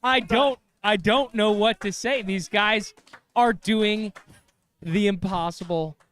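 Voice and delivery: high, loud thrilled commentary; real excitement, almost breathless; excited. Real, not acted. Raving about impossible golf.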